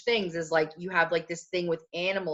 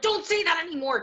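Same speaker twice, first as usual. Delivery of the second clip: high, insistent mock protest; retold protest; 10 semitones higher than usual. Woman retelling her old plea to her fiancé, high protest. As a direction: exclaim it, pitch jumping up.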